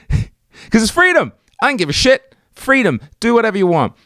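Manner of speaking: high, laughing, mock-patriotic glee; hammed-up gun-range euphoria; giddy freedom.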